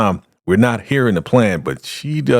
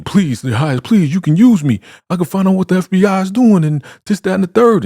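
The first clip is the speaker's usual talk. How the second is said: high, louder mock begging; pleading; 12 semitones higher, 5 dB louder than usual. Condemned man begging the leader to spare him, high mock pleading. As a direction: plead in a raised, urgent voice. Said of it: "And he's pleading".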